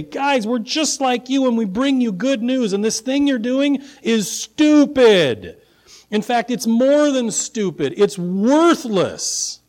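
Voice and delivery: impassioned preaching with punched key words; bold confrontation. Acted. Paul and Barnabas confronting worshippers of Zeus, bold proclamation. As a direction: confront them head-on, driving each charge home. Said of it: "Paul and Barnabas boldly say".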